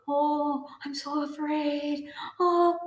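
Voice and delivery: high, slow hammed-up fear; mock fear. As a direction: whimper it in mocking fright.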